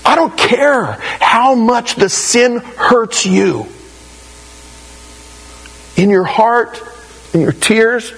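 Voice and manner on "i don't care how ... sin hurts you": higher and slow, each word pressed; emphatic preaching. Real, not acted. Preacher insists to the congregation, slow and emphatic.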